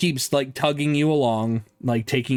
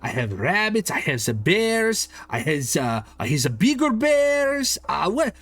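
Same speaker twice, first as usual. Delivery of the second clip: high, broken-English shopkeeper voice; eccentric toymaker; 5 semitones higher than usual. Acted. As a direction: greet the customer with a high, odd accent. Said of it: "he goes".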